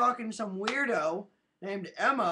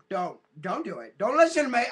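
usual speaking voice

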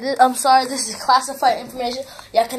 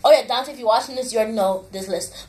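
ordinary delivery

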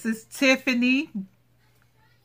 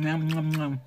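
playful eating noises; 4 semitones lower than usual. Pretend. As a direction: pretend to munch happily. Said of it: "That's like eating".

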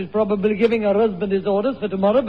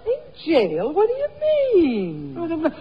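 very high, startled question; shocked high question; 8 semitones higher, slower than usual. Acted. Mother to her son about jail, startled. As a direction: jump high in disbelief.

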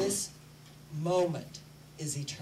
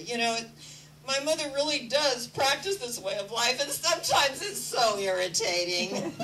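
higher, louder mock daughter voice with a laugh; daughter's complaint; 4 semitones higher, 5 dB louder than usual. Mother imitates her daughter's complaint about her, laughing. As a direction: play the exasperated daughter, half-laughing. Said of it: "my daughter used to say".

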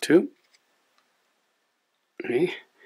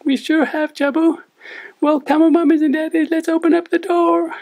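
squeaky, high cartoon puppet sidekick; chirpy little puppet, much higher; 10 dB louder than usual. Puppet co-host Lani speaks.

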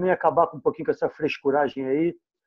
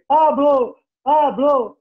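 loud mock siren call, repeated; hammed-up siren-like call; calling out a name; 7 semitones higher, 4 dB louder, much slower than usual. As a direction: shout the name like an alarm going off. Sirene gritava pra você.

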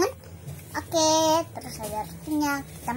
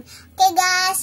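excited; 7 semitones higher, 7 dB louder than usual. Real, not acted. Cheerful announcement to viewers.

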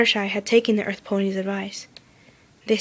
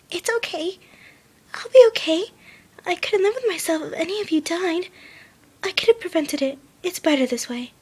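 hesitant; 9 semitones higher than usual. A narrator's reading. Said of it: "once again hesitant".

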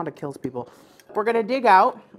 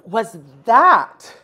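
disgusted; 8 semitones higher, 5 dB louder, much slower than usual. Real, not acted. Reacting to awful cherry.

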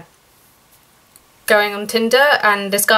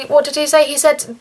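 usual speaking voice

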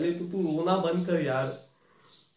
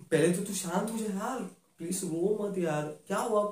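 ordinary talk

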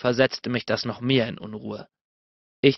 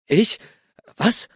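stuttering; 8 semitones higher than usual. A narrator's reading. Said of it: "stotterte ich".

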